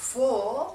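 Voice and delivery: "for" with a long vowel and silent r. Shown as a demonstration. Teacher showing viewers the drawn-out o, held long. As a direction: stretch "for" loudly, no r. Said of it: "او کشیده هست".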